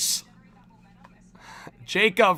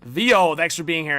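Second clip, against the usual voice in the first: ordinary delivery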